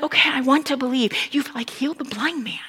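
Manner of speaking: soft, unsure half-whisper; hesitant whisper. Retold or imitated. Doubting listener to Jesus, whispering. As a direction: confide a doubt under the breath. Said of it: "Others would have been like".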